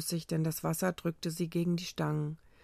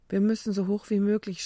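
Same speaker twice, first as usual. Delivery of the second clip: raised, louder urgent call; narrated shout; 3 semitones higher, 6 dB louder than usual. A narrator's reading. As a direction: call out over the noise of approaching waves. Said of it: "schrie Rebecca". (lauter, dringender Ruf)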